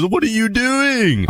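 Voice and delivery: high, slow disbelief; slow, high exasperation; exasperated. Real, not acted. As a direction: scold the silly villager. Villager jumps back in.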